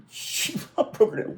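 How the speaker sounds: words lost in high laughter; slow, breathless, giggling words; laughing. Real, not acted. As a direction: choke on the laughter. Laughing too hard to speak.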